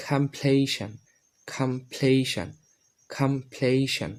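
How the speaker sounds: কমপ্লিশন slow, "kham-PLAY-shan", falling; new pronunciation. Shown as a demonstration. Teacher models for learners, কমপ্লিশন as slow "kham-playshan". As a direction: say কমপ্লিশন slowly with aspirated k and "shan" ending. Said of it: "এই চারটা উচ্চারণ করি".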